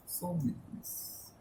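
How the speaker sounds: breathy whisper, dropping almost to nothing; whispered echo. Shown as a demonstration. Preacher whispering his own phrase back to the congregation, hushed. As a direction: let the words fade out as a hushed echo.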